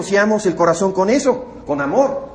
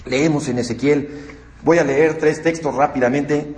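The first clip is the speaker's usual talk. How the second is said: normal talking voice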